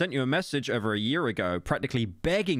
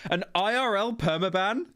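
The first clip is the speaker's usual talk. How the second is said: incredulous; 8 semitones higher than usual. Real, not acted. Incredulous repeat of the rule.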